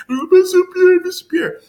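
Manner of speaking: very high, loud pleading, hammed-up; mock tearful begging. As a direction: imitate crying kids begging for mercy. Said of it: "even us begging".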